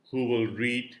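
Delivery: usual speaking voice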